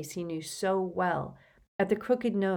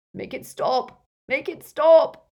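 high moaning, building to a wail; anguished moan; 9 semitones higher, 10 dB louder, slower than usual. A narrator's reading. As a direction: plead loudly through torment. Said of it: "moaned Dumbledore".

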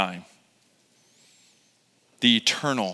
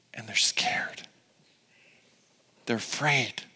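whispered, much softer; hushed whisper; 4 semitones lower, 10 dB quieter than usual. Acted. Pastor describing the fearful Israelites, whispered. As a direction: breathe it like a secret.

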